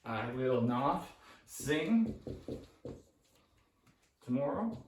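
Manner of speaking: each word drawn out, x0.5; slow dictation. Shown as a demonstration. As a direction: spell it out slowly while writing. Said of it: "dictating while writing".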